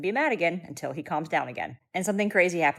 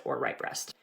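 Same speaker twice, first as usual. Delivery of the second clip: whispered aside; 4 semitones lower, 6 dB quieter, slower than usual. Real, not acted. Sleep-deprived mom joke aside.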